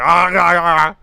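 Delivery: ordinary delivery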